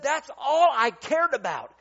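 loud, high and drawn out; emphatic declaration. Retold or imitated. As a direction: retell it with slow, forceful conviction. Retold driver's emphatic declaration.

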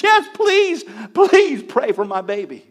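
high, loud plea that eases down; desperate pleading. Retold or imitated. Mother begging the boy to pray for her feverish baby, pleading. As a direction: beg for help as an acted desperate mother.

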